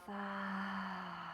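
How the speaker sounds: breathy sigh through the open mouth; open-mouth exhale. Shown as a demonstration. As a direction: release the breath audibly. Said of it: "And open mouth".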